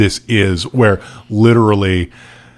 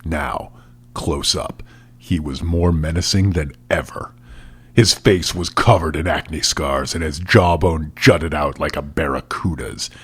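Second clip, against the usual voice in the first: low, hushed storyteller narration; dramatic story reading; 3 semitones lower, 3 dB quieter than usual. Acted. Podcast host reading a villain's entrance, hushed and dramatic. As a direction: read it like a menacing book scene.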